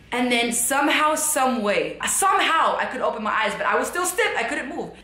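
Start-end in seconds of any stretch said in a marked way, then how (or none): none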